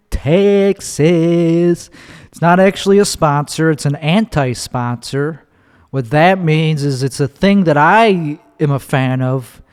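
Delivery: ordinary delivery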